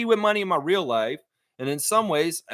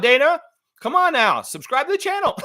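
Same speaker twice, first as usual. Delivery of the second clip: high, raised-voice playful plea; calling out; 11 semitones higher, 4 dB louder than usual. Real, not acted. Host urging Dana to get into the chat, high calling out. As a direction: call out to someone missing, pitched way up. Calls out to absent chat member.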